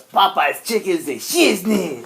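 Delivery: lightly voiced demon.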